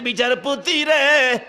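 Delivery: acted chanted entreaty, easing downward; pleading call. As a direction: call out to the judge, begging for a chance. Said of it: "যুবক ডাকতেছে".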